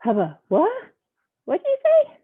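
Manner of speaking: shrill, loud disbelief; astonished. Acted. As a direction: squeak out the question. Pigeon astonished.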